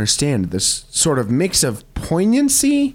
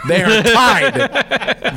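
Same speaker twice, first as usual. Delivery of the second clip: slow, high, louder proclamation of tied; grand pronouncement; 9 semitones higher, 4 dB louder, much slower than usual. Real, not acted. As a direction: stretch out They are tied like a royal decree.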